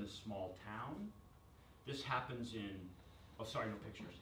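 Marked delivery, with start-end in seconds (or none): none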